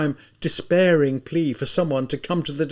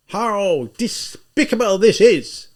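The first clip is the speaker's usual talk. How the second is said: high, louder, slow outraged cry; 6 semitones higher, 5 dB louder, slower than usual. Acted. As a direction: ring out from the doorway in disgust. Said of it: "a loud voice suddenly rang out".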